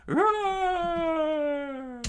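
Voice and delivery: very high, loud shouted roar; battle roar. Acted. Soldiers answering their captain with a loud roar. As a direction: roar like an army. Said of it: "screamed out".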